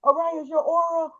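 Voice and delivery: high, wonderstruck retelling; excited amazement. Retold or imitated. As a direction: re-enact gushing over an amazing sight. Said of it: "You know, I was just so excited".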